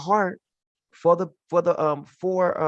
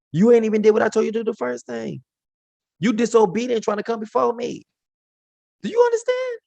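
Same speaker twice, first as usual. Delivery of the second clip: higher and louder, quick scolding; stern rebuke; 7 semitones higher, 3 dB louder than usual. Retold or imitated. God rebuking Saul for disobedience, stern and scolding. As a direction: scold him like a father who was defied.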